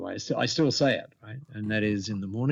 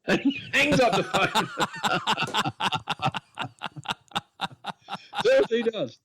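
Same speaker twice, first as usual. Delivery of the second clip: high-pitched, helpless laughter through the words; laughing hard; 10 semitones higher than usual. Real, not acted. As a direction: crack up at your own punchline. Cracks up at own punchline.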